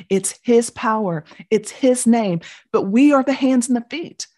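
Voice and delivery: full-force preaching. Acted. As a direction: preach it to the rafters. Sermon climax.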